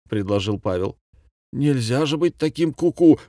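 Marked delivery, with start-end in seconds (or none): 1.5-3.2 higher, mildly exasperated outburst; stormy reaction. A narrator's reading. Commandant objecting to Pavel's suggestion, mildly exasperated. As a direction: react with a touch of outrage. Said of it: "бурно отреагировал Фокусов-Веселовский".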